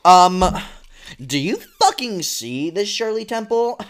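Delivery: loud, high angry complaining; rude customer. Pretend. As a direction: berate the waitress.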